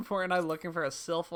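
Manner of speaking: normal talking voice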